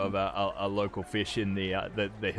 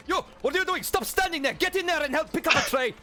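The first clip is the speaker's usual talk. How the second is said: high, loud, bossy chef voice yelling orders; hammed-up frantic head chef barking at the help; shouting chef; 5 dB louder, faster than usual. Head chef yelling at the disguised newcomer, high and loud. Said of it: "He's like".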